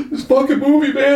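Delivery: very high, loud mock sobbing voice; hammed-up weepy squeal; imitating bawling. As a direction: wail it like someone falling apart in tears. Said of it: "he's just bawling".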